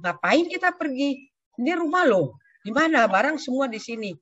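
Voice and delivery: a hint of an annoyed husband; husband's retort.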